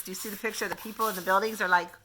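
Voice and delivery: plain reading voice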